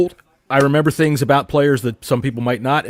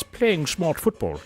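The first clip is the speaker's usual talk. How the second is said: Lord Tewksbury voice; 3 semitones higher, 7 dB quieter, slower than usual. Retold or imitated. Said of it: "like Lord Tewksbury says".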